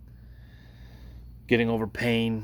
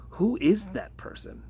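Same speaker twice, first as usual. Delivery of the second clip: slow, much higher bewildered question; retold bewilderment; 10 semitones higher than usual. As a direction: ask it slowly, high and baffled. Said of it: "I was like".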